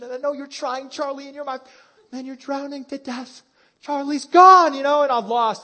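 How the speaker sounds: high, pleading fan building to a loud wail; mock-anguished plea to a TV character, cracking into a cry at the end; heartbroken fan. Fan crying out to dying Charlie, high and rising to a loud wail.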